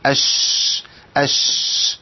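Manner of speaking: أش with a long hissed sh that fades by itself; أش drawn out, whispered sh; sustained sh demo. Shown as a demonstration. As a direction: hold the sh of أش until it dies out. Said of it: "لازم تطلع بالصوت ده".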